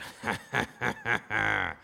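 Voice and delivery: low sly chuckle of a scheming king; a mock villain's laugh; devious laugh. King Saul at dinner, sly devious laugh. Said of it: "this devious laugh".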